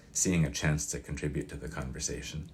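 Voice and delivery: ordinary delivery, nothing marked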